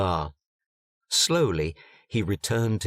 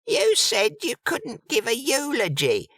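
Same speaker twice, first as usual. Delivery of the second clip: much higher, fluttery fortune-teller voice; 10 semitones higher than usual. A narrator's reading. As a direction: gush about the stars, way up high.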